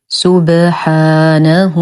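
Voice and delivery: very slow سبحانه with bounced ب; recited with qalqalah. Shown as a demonstration. Teacher reciting the word, slow سبحانه with echoing ba. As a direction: chant سبحانه, echo the ب and stretch the "haa". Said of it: "نقلقل الباء".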